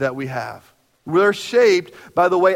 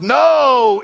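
high, loud shouted "No"; acted sharp no; 9 semitones higher, 7 dB louder than usual. Family member vetoes Torchy's Tacos with a loud shouted no. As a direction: blurt out a flat refusal. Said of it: "the first response is".

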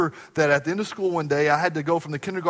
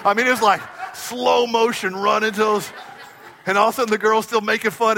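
excited laughing retelling; 6 semitones higher, 5 dB louder than usual. Retold or imitated. Excited retelling of schoolyard fight.

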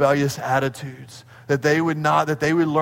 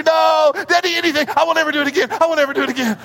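put-on high, loud childish pleading; begging child; 10 semitones higher, 4 dB louder, much faster than usual. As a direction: promise anything like a frightened kid. [name] pleading.

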